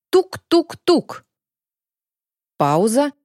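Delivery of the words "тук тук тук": slow, high knock-knock-knock; knocking imitation. A narrator's reading. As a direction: tap out the knocks slowly. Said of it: "наносил ритмичные удары по двери".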